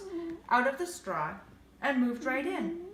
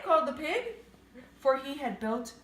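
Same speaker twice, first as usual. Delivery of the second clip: plain reading voice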